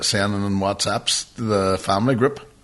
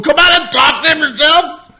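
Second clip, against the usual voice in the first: loud, high mock shout at the wife; a retold shout at his wife; heated retort; 9 dB louder than usual. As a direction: throw it back at her, raised and high. Retelling argument with wife, raised voice.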